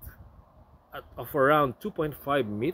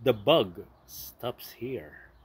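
slow dramatic slogan read; 5 semitones lower, 5 dB quieter, slower than usual. Shown as a demonstration. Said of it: "It says here".

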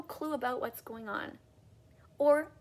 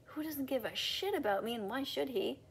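ordinary delivery

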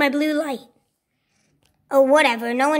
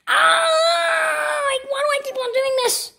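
high wailing complaint; pained frustration; 9 semitones higher than usual. Acted. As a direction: wail in pain at your own clumsiness. Keeps hurting himself.

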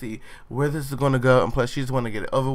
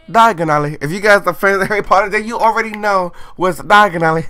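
higher, louder, fast fan gush; nerdy excitement; 8 semitones higher, 8 dB louder than usual. Real, not acted. Vlogger to fans about Harry Potter, excited gush. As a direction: gush like a proud nerd.

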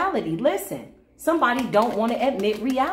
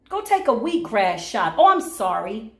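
normal talking voice

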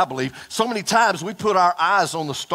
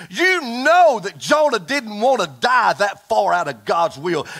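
usual speaking voice

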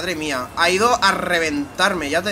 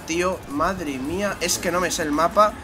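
normal talking voice

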